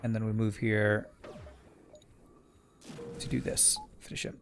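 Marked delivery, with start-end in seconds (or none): 3.2-4.4 hushed, breathy whisper. Real, not acted. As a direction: whisper it tensely under your breath. Tense moment in fight.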